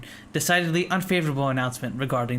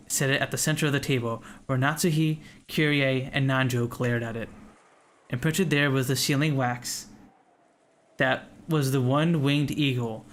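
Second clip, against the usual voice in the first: ordinary talk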